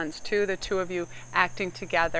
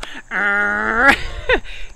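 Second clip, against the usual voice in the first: loud rolling mock growl; long, loud "arrr" breaking into laughter; comic growl; 4 semitones higher, 9 dB louder than usual. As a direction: rev up a big playful growl. Comic noise after "here we go.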